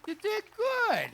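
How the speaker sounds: very high, slow You did good; big dog praise. Real, not acted. Praising dog after retrieve.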